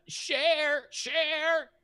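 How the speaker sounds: very high, drawn-out creature call; dragon voice. Acted. Dragon repeats its demand to the party, very high creature voice. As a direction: stretch each share up high like a beast. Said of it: "starts looking at all of you and says again".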